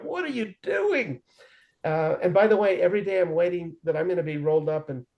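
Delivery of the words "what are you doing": high, slow, baffled retort; incredulous squeak. Retold or imitated. Answering the inmate's invitation, incredulous and high. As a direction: pitch way up, drawn out in disbelief.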